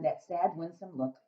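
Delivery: usual speaking voice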